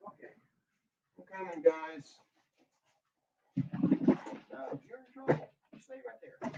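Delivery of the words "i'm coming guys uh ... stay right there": high, distant, half-whispered scolding of a pet; talking to the dog. Real, not acted. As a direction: gently warn the dog from across the room. Scolding dog from far room.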